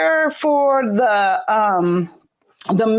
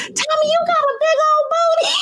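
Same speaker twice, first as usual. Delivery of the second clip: excited shout. Real, not acted. Teasing guest, shouting and laughing.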